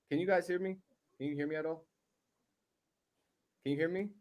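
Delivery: muffled, distant voice. Real, not acted. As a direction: speak through a covered mic. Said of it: "It's muffled".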